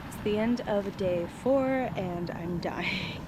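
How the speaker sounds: slow exhausted whisper; worn-out hushed voice. Real, not acted.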